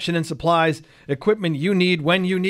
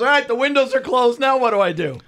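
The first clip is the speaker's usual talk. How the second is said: raised, louder voice of a puzzled dad; retold dad on the phone; 9 semitones higher, 4 dB louder than usual. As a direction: act out dad earnestly asking tech support.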